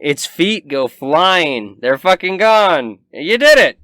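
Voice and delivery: loud, high, building shout of victory; hyped game master hollering the win; triumphant cheer. Real, not acted. Cheering the defeated monster's end.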